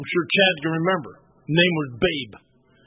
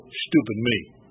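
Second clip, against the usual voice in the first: ordinary talk, nothing marked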